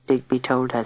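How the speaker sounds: ordinary delivery, nothing marked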